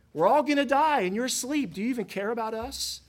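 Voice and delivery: high, loud panicked cry, easing off toward the end; frantic, blaming the sleeper; panicked accusation. Retold or imitated. Terrified disciples waking Jesus in the storm, panicked and accusing. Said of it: "impugns his character".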